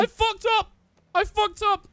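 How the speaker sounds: high, loud distressed yelling; panicked admission. Real, not acted. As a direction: cry it out in dismay. Downed after own mistake.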